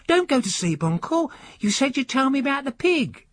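put-on a high, eager boy begging his uncle; the nephew keeping the old man awake; young boy nudging sleepy uncle, high and pleading; young boy's voice.

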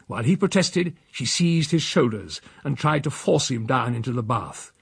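ordinary delivery

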